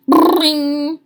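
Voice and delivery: loud, high bell imitation; school bell ring. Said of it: "went the bell".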